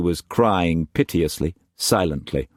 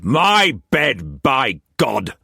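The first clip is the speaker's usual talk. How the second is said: snarling; 7 semitones higher, much slower than usual. A narrator's reading. Struan calling Mei Mei to his room, snarl. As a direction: snarl it at her back. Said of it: "he snarled".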